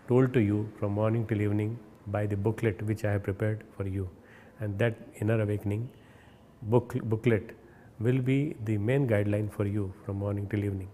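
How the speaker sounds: ordinary talk, nothing marked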